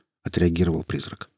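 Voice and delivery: plain reading voice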